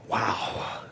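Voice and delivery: low, soft, awed "wow"; hushed awe. Real, not acted. Preacher after retelling a conversion, hushed awe. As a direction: let it drop almost to nothing in wonder.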